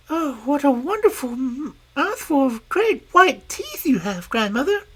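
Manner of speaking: high, quick and innocent; little girl eagerly telling the wolf her errand. Acted. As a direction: chatter like a trusting child. Girl character voice.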